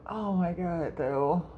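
usual speaking voice

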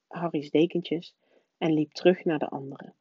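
ordinary delivery, nothing marked

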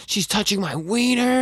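very high, slow mock-panicked squeal; freaking out. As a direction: squeak it out in hammed-up alarm. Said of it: "he's, like, freaking out".

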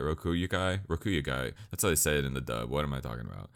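normal talking voice

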